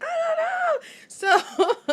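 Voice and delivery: very high, loud, slow laughing wail. Retold or imitated. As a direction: wail it helplessly through laughter. Child wailing, caught out.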